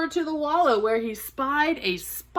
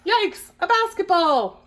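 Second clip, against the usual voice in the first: high, louder, stretched exclamation; startled; 8 semitones higher, 4 dB louder, slower than usual. Acted. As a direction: cry out in comic alarm. Character alarmed in story.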